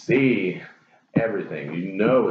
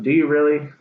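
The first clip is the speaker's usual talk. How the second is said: louder, higher, doubting; skeptical retort; 7 semitones higher, 4 dB louder, faster than usual. Real, not acted. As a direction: challenge the excuse, incredulous. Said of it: "skeptical retort".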